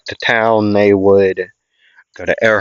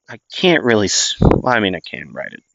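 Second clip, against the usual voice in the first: ordinary delivery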